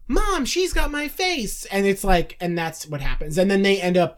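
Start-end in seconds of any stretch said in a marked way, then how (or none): 0.1-1.6 high, drawn-out cry to mom; mock astonished twin. Long-lost twin calling to mom about her double, high mock astonishment. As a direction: imitate a sitcom kid's astonished discovery. Twin voiced in retold sitcom scene.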